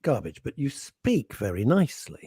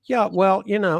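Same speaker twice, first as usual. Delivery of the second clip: mock American accent; 8 semitones higher, 3 dB louder than usual. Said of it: "Lady Victoria Harvey was like".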